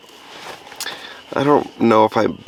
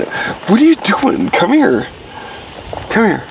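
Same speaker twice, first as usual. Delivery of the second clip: high pet voice; 9 semitones higher than usual. Real, not acted. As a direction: coo at the dog as if baffled. Coaxing the groaning dog Monty.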